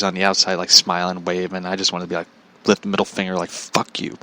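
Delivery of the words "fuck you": voiceless, hushed curse; muttered resentment. Retold or imitated. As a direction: curse softly under your breath. Silent resentment acted to himself.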